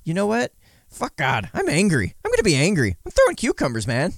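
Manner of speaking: hammed-up angry rant, shooting up on cucumbers; mock angry rant. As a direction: ham up a tantrum. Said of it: "I'm sitting here going".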